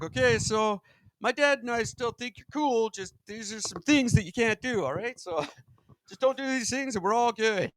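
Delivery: high-pitched, chummy go-between voice; comic high-voiced Jesus laying down rules; Jesus pleading reasonably. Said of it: "he's like".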